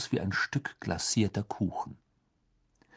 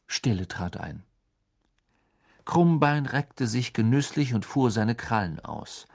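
usual speaking voice

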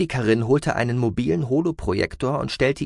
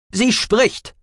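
high, loud character cry; urgent, high-pitched exclamation; excited call; 13 semitones higher, 6 dB louder than usual. A narrator's reading. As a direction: call out from the background. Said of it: "rief Aspartamin aus dem Hintergrund".

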